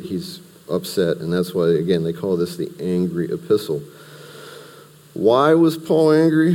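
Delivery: ordinary talk, nothing marked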